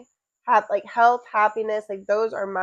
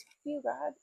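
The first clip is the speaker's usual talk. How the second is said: inner thought; 5 semitones higher, 12 dB quieter than usual. Retold or imitated. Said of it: "one of the thoughts in my head was".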